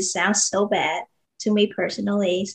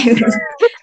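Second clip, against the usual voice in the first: high burst of laughter; loud giggle; 6 dB louder than usual. Real, not acted. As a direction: let a big giggle escape. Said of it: "You can laugh".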